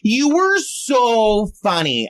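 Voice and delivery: put-on high, slow drunken gushing; hammed-up drunk compliment; drunk girl. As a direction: imitate a tipsy girl, dragging out the words. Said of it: "this really drunk girl comes up to me".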